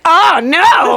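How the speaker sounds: high, hammed-up dismay; mock horror. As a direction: cry out in comic alarm. Retold horrified reaction.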